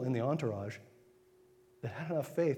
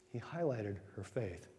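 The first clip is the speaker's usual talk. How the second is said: soft echo; 3 semitones lower, 7 dB quieter than usual. Shown as a demonstration.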